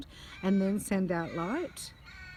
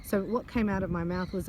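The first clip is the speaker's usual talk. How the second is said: usual speaking voice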